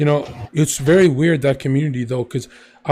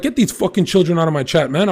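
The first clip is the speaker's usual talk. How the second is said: ordinary delivery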